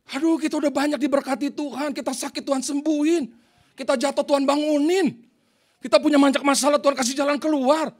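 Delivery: high, loud exhorting sermon; forceful preaching. Real, not acted. Preacher scolds congregation over stingy offerings, forceful preaching. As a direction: drive each line up and out to the congregation.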